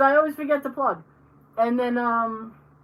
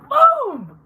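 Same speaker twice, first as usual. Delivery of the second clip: sudden high loud "Boom!"; triumphant boom; 13 semitones higher, 8 dB louder than usual. Real, not acted. Celebrating getting every name right, loud triumphant boom. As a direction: shout it out in triumph.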